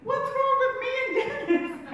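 high, loud put-upon protest; mock offended husband. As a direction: toss it back like a wounded husband. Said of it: "and he's like".

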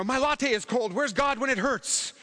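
high-pitched mock whine; hammed-up whine over a tiny hurt; whining. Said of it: "I can sometimes be a whiner".

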